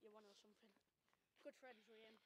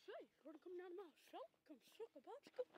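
silly shopkeeper voice; 6 semitones higher than usual. Pretend. Mock shopkeeper ad bit.

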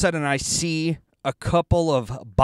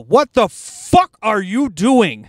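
loud, drawn-out outraged yell, pitched up; retold yell; 6 semitones higher, 6 dB louder, slower than usual. Driver yells at a cyclist overtaking on the left, re-enacted outraged yell. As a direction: re-enact yelling at someone, stretching the words. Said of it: "and then I yell".

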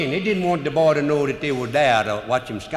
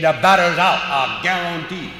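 insistent umpire; 5 semitones higher, 7 dB louder than usual. Retold or imitated. Umpire insisting on his call.

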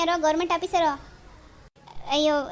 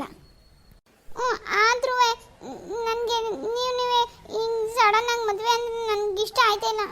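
whimpering; 6 semitones higher, slower than usual. Acted. Daughter whimpering her reluctance to Satish. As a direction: whine unwillingly.

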